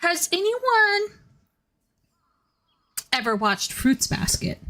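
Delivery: high, slow sing-song voice; playful high-pitched ask to chat; sing-song question. Pretend. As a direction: float the question up high and drawn out. Asks chat, then repeats it.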